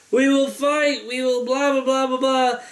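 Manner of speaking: high-pitched mock rallying cry; hammed-up rebel proclamation droning into blahs; mock propaganda speech. Katniss rallying viewers in a propaganda commercial, mock-heroic.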